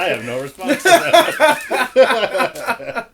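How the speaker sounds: high, loud helpless laughter through words; stretched-out words bursting into laughter; laughing. Real, not acted. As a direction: crack up while answering. Cracks up at tongue remark.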